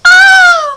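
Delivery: high, loud yelp of "oh"; startled cry. Real, not acted. Startled during filming.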